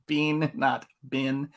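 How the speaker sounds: "Bean" long ee, "bin" short i; bean against bin. Shown as a demonstration. Showing Bancroft's way, slow "Bean" long, "bin" short. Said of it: "Bancroft says, "Bean, not bin".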